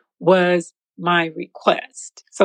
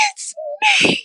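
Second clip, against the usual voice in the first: crying shout; slower than usual. Retold or imitated. Jumping up and down in church.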